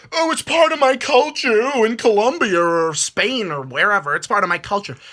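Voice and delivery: high, put-on whiny voice mocking the excuse. Mocking animal fight defenders and their culture excuse, a high sneering whine. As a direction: sneer out the excuse as if someone else is saying it.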